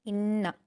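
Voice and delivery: "इन्ना" with the doubled n hummed and held; nasal ghunna. Shown as a demonstration. Teacher demonstrating ghunna, humming n in "इन्ना". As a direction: hold the n of "इन्ना" through the nose before releasing. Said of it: "गुन्ना के साथ पढ़ेंगे".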